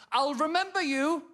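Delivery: high, strange parting threat; high-pitched mock menace; lollipop lady. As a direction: imitate her calling a warning after you. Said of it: "lollipop lady said something really weird".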